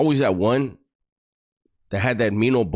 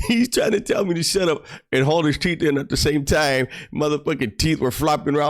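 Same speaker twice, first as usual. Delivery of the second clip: words squeezed out between giggles; real giggly telling; laughing while talking; 6 semitones higher than usual. Real, not acted. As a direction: talk through the laughter. Still laughing through the line.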